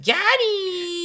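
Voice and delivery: put-on squeaky high little girl gloating; high tiny voice, triumphant; helium voice. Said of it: "cutest little helium voice".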